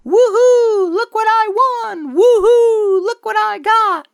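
very high, loud, mock cheering; hammed-up excited whoop. Guy showing off his prize on Monday, hammed-up whooping. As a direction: whoop it up like a giddy prize winner. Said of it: "a guy going".

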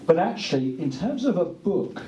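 usual speaking voice

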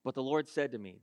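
normal talking voice

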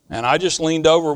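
ordinary talk, nothing marked

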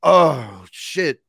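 loud, high "oh" of shock; retold jolt of amazement; stunned reaction. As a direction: burst out, overwhelmed. Retold shock, cannot let go.

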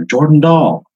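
voice calling from inside. Acted. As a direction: call it out from another room. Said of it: "you hear from inside, you hear".

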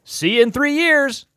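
high, loud, drawn-out quote; emphatic mock quote. Quoting the film's ending with emphasis.

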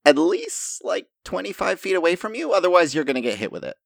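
high-pitched character voice, a cautioning answer; Lorilla the gadget maker. Acted. Lorilla warns players how far to throw it, high-pitched character voice.

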